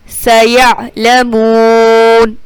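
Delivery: slow, drawn-out tajweed chant; Quran recitation. Shown as a demonstration. Teacher reciting the verse to students, slow tajweed chant. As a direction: stretch each vowel in measured recitation.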